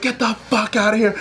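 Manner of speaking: high, strained imitation of an instructor's order; hammed-up instructor chasing a recruit off; mock drill instructor. Drill instructor throws recruit out of shower, mock strained order. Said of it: "And then he's like".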